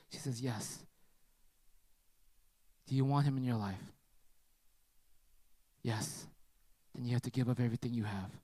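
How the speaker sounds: low, soft, sincere appeal; tender reassurance. Retold or imitated. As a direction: gently offer comfort, pausing often. Gently offers hope to hurting girl.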